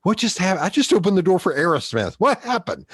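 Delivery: high, bewildered retold outburst; freaked out. Friend who held the door for Aerosmith, freaked out. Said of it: "Like, he freaked out".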